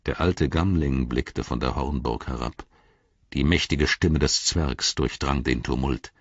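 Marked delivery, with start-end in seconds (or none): none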